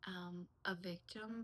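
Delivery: normal talking voice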